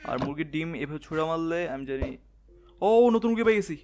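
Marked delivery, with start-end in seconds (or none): 2.8-3.8 high, loud burst of excitement; excited delight. Real, not acted. Gamer finds a new chicken by luck, excited delight. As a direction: exclaim it with real delight, pitched up.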